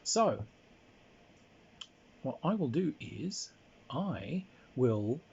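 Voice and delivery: ordinary talk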